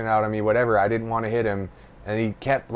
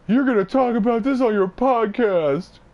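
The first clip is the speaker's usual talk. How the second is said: imitating Justin; 13 semitones higher, 5 dB louder than usual. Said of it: "he gave it to me".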